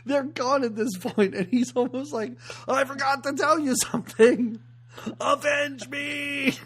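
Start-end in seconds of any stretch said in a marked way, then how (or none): 2.7-6.5 high, loud hammed-up cry of avenge me; mock yell. Cohost retelling the dad, mock yelling through the fence. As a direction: belt it out like a dying father's last order. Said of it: "And he yells it".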